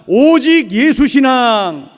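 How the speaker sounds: loud, slow call to repeat; emphatic unison phrase; slogan for the congregation. Shown as a demonstration. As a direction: lead the people in a chant. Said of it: "우리 같이 한번 따라합니다".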